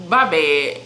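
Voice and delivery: ordinary delivery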